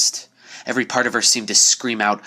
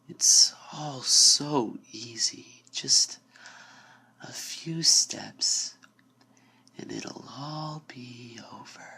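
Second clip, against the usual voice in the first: slow, soft, breathy-edged whisper luring her in; a door whispering her toward the void, seductive and slow; 7 dB quieter, slower than usual. Acted. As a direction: whisper like a patient lure from the dark. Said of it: "low and seductive".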